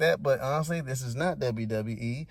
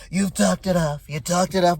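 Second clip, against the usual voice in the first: imitated interruption, louder; lightly voiced overbearing president, raised volume; Trump; 3 semitones higher, 5 dB louder than usual. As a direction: cut in with mock impatience. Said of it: "Trump was like".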